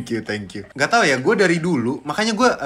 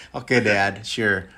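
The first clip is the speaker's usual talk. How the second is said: low, resigned; 4 semitones lower, much slower than usual. Retold or imitated. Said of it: "Gue kayak".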